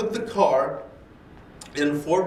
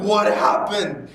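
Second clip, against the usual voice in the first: laughing disbelief; 5 semitones higher, 5 dB louder, slower than usual. Retold or imitated. Car catches fire.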